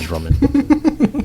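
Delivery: slow, high, stretched-out name; stretched high playful echo; drawn-out echo. Pretend. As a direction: drag the name out high like a joke. Echoes co-host, drawn out.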